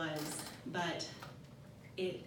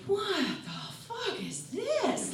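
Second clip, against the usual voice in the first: high, slow, disbelieving cry; can't believe what's happening to her; bewildered outburst; 13 semitones higher, much slower than usual. Retold or imitated. Crying in the car, asks herself in disbelief. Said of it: "it's like".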